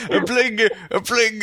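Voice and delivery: high mock stammer; mocking stuck speech. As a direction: parody someone stuck on a word. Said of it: "You're really stuck in that word".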